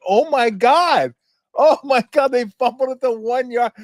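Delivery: high, loud oh my God; high loud giddy exclaim; excited disbelief. Retold or imitated. Reliving fumble shock.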